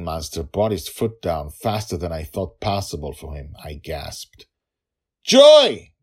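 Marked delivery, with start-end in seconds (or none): 5.2-5.9 high, very loud cry of a name; panicked yell. Acted. Father sees the foot come down, panicked yell. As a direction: scream her name in terror. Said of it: "Dragonfather yelled".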